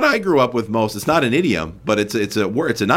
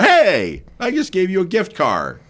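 indignant gift giver; 8 semitones higher, slower than usual. Acted. Acted complaint from car giver.

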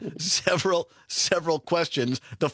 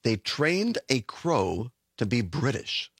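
slow, lower echo of "They trained a crow to be British"; slow repeat; 6 semitones lower, slower than usual. Shown as a demonstration. Co-host echoes the headline slowly and lower.

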